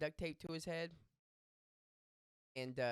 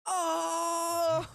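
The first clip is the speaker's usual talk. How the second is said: long, high, very loud "oh"; melon man's bellow; 11 dB louder than usual. Retold or imitated. Costumed guy seeing the friends again, huge shout. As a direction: belt out a sustained holler. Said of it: "and again goes".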